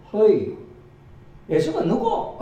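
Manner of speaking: very high, laughing Gyeongsang granny question; a clueless old country woman piping up; Gyeongsang grandma. Acted. Silent grandma finally asks who Jesus is, in high Gyeongsang dialect. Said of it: "아무 말도 안 하던 할매 하나가".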